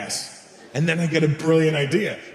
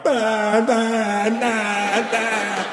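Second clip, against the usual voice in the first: mocking babble; 7 semitones higher, much slower than usual. Comedian's brain reacting to people.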